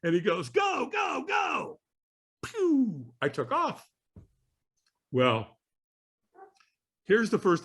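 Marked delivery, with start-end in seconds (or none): 0.5-1.8 high, drawn-out imitated shout; mock trainer's launch cry; urging shout. Trainer sending walker onto coals, urging shout. 2.4-3.1 high-pitched "pew" take-off sound; whoosh noise. Retold or imitated. Walker taking off across coals, imitated zoom.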